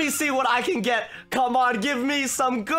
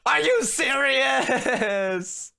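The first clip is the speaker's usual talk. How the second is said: drawn-out disbelief; much slower than usual. Real, not acted. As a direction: stretch it out in outrage. Died right after.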